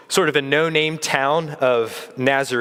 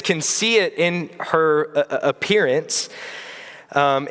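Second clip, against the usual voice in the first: normal talking voice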